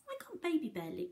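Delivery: ordinary talk, nothing marked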